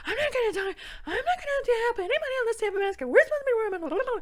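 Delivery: very high, loud, rushed whine; hammed-up high-pitched tirade; mocking indignant cashier. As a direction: squawk it fast in mocking imitation. Mimicking the cashier's indignant retort.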